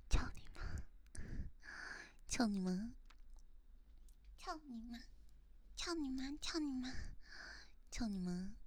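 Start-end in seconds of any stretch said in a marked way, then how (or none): none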